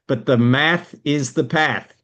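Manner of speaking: slow American accent, "path" rhymes with "math". Shown as a demonstration. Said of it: "only works in an American accent".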